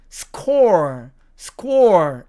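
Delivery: SCORE with the r sounded; American score variant. Shown as a demonstration. Teacher showing the other American way, SCORE with r. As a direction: finish SCORE on a clear r. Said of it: "미국식으로 발음하면".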